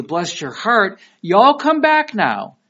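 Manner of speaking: high, loud, drawn-out mock Southern send-off; Southern sweet farewell. Southerner seeing visitors off, mock-sweet and drawn out. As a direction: lay on the Southern hospitality, slowing and rising. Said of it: "down in the South, they'll do the".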